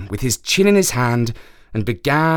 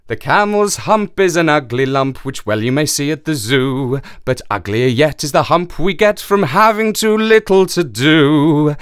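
poem reading; 3 semitones higher, 4 dB louder than usual. Acted. Reading Kipling's little song.

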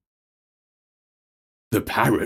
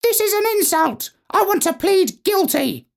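squawk. Acted. Parrot Major protesting to the judge, squawking. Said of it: "the Major squawked".

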